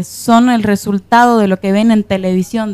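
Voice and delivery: usual speaking voice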